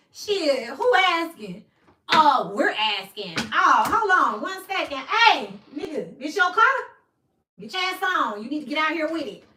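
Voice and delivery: loud, high, pushy doorstep back-and-forth; mock confrontation. Acting out a loud standoff with police at her door. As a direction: shout it like you're facing down the cops at the door.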